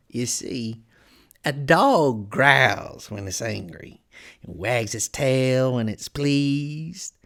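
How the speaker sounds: slow, drawn-out, swooping cat voice; sly Cheshire Cat. A narrator's reading. Cat proving itself mad, slow and relishing it. Cheshire Cat's proof of madness.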